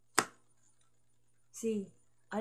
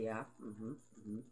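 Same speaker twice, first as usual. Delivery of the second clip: skeptical family; 9 semitones lower, 9 dB quieter, slower than usual. Retold or imitated. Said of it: "they'll say".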